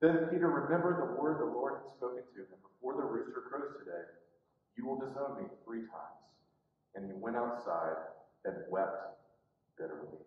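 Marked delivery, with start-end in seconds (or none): 2.6-6.2 low, hushed, solemn quote; grave, sunken voice; remembered warning. Retold or imitated. Jesus's words remembered by Peter. 7.0-10.2 very low, hushed telling; grave, near-silent close; sombre narration. A narrator's reading. Sorrowful climax of Peter's denial.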